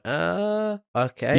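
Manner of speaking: mock bewilderment at an abrupt ending; baffled reaction.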